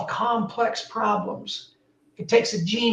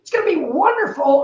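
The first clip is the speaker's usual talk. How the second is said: high, fast, hyped-up sales shout; mock yelling pitch; 8 semitones higher, 4 dB louder, faster than usual. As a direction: burst in yelling like an overexcited salesman. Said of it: "start yelling and screaming".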